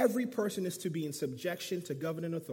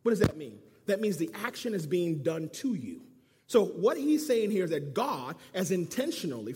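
ordinary delivery

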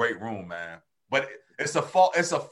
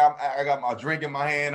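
ordinary talk, nothing marked

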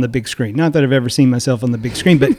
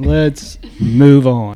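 slow drawn-out repeat; much slower than usual. Shown as a demonstration. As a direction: stretch each word slowly.

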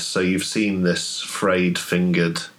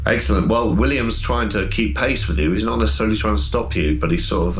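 ordinary delivery

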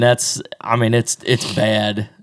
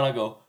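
high loud yawn noise with a laugh. Shown as a demonstration. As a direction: let out a big yawning howl. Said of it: "I'll yawn".

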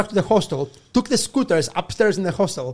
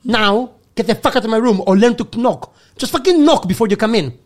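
raised, emphatic retold telling-off; exasperated scolding; 4 semitones higher, 4 dB louder than usual. Guest telling manager to learn to knock, exasperated. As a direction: lay into him, pitch up on every phrase.

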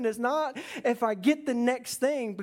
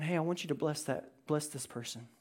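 softer and lower, a gentle nudge; gentle whisper; 7 semitones lower, 8 dB quieter than usual. Acted. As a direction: murmur it like a quiet inner voice. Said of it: "God may whisper in your ear".

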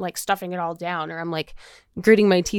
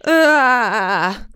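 loud embarrassed groan; 3 semitones higher, 7 dB louder than usual. Retold or imitated. Reacting to fainting in front of people.

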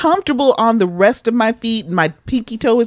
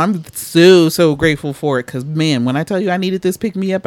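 ordinary talk

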